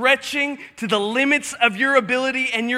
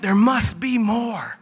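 ordinary delivery

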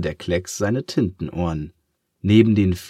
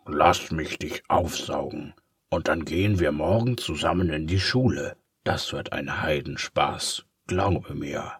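softer, lightly voiced tempter; a hint of sly menace; sly villain; 3 semitones lower, 3 dB quieter than usual. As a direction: lure him sweetly. Villain luring the blot.

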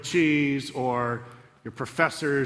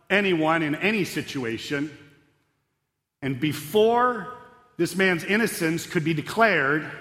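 normal talking voice

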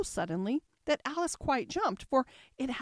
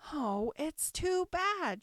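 slow, dragged-out dismayed cry; a girl crying out at a house in her way; 7 semitones higher, much slower than usual. A narrator's reading. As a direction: wail it out in frustration. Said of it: "she cried".

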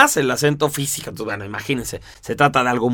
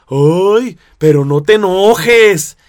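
louder, higher, drawn-out chiding; mock admonishing; 3 semitones higher, 6 dB louder, much slower than usual. Someone tells a marcial not to get angry, mock chiding. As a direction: chide with a stretched, emphatic plea. Quotes the phrase that enrages marciales.

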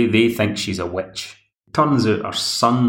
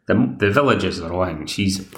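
normal talking voice

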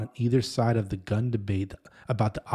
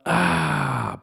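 raised, drawn-out "aah"; exclaimed "ah"; 5 semitones higher, 4 dB louder than usual. Retold or imitated. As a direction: let out a loud, long "ah". Exclaims in retold inner thought.

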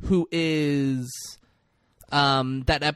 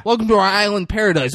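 fast, loud, higher singsong welcome; hammed-up hostess; 9 semitones higher, 8 dB louder, faster than usual. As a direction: gush it like a cheesy resort host. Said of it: "She said".

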